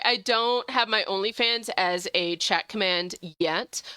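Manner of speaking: ordinary delivery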